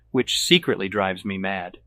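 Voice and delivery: ordinary delivery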